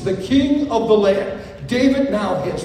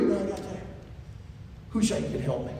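calm David; 2 semitones lower, 10 dB quieter, faster than usual. Acted. David answering Hushai.

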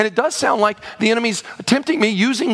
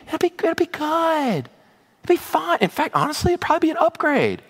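higher, lightly coaxing promise; mock reassurance; 6 semitones higher than usual. Preacher mimicking the enemy's empty promise, mock coaxing. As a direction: sell the false comfort sweetly. Mocks the envoy's false reassurance.

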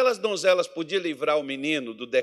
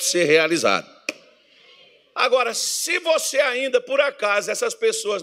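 louder, rhythmic call and response; full-force preaching; 6 semitones higher, 6 dB louder than usual. Acted. Preacher pressing the congregation with irmão, loudly. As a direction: drive each question home to the church.

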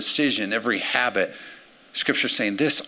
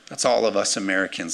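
usual speaking voice

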